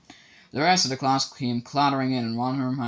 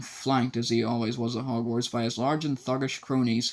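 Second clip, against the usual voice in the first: usual speaking voice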